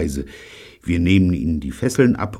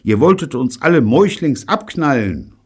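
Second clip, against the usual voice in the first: high, loud outrage; raised-voice indignation; angry accusation; 7 semitones higher, 5 dB louder than usual. Acted. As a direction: accuse them furiously. Evelyn's furious accusation continues.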